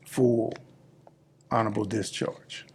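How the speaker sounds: ordinary talk, nothing marked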